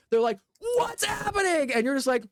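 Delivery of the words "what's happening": high-pitched cry of bewilderment; crowd's alarm. Acted. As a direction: cry out like a startled onlooker. Voicing the panicking crowd at show.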